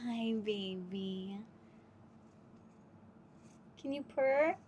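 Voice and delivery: soft, slow murmured greeting to a pet. Real, not acted. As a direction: hush it slowly like waking a sleeping cat. Cooing to sleepy cat.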